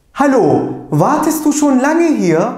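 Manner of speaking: loud, clear German line for the class to answer; loud prompt for learners. Shown as a demonstration. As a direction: call the line out for students to reply. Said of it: "bu diyaloğu tekrarlayalım".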